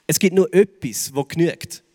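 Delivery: ordinary talk, nothing marked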